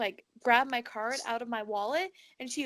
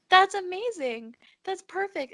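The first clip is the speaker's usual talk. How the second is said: excited; 7 semitones higher, 4 dB louder than usual. Retold or imitated. Thrilled quote in dream story.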